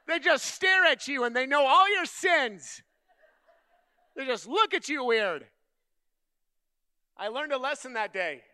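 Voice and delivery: loud, high, driving sermon voice; full-force preaching. Acted. Preacher building the story for his congregation, loud and high preaching. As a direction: push it out loud and high to the room.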